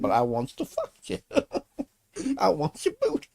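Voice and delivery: mock prison creep voice, laughing through it; lightly voiced leering inmate; imitating the booty warrior. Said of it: "He's like".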